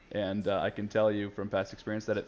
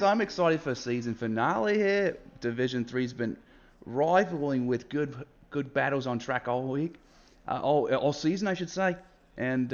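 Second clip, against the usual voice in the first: ordinary talk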